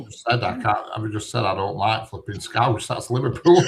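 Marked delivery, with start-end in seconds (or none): 2.9-3.7 laughing. Real, not acted. Laughing at his own contradiction.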